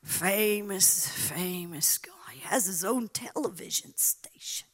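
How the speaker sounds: conspiratorial whisper. Real, not acted. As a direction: lean in and whisper it. Whispered mocking aside.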